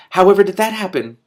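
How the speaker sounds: high, loud astonished squeak; squeaky astonishment. Acted. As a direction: squeal it in disbelief. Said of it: "he squeaked".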